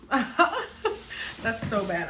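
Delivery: laughs through the words, slowly; giggling at herself, slow and amused; laughing. Real, not acted. Laughing at her own habit.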